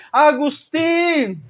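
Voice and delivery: high drawn-out hail without real volume; mock calling out. Woman keeps calling Agustín as he walks on, mock calling out. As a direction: stretch the name high, imitating an insistent caller. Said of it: "Ella comenzó a gritarle".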